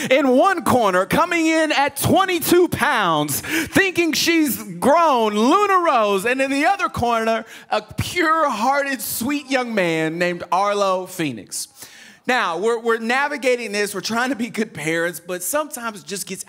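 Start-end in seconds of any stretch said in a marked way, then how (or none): 0.1-11.7 drawn-out boxing announcer intro; hyped fight-night introduction, words held long; ring announcer. Acted. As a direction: announce them like prizefighters, stretching the names. Mock introduction of children as fighters.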